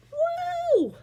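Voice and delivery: very high whoop. Acted. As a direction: crowd of goblins cheering. Goblins cheering.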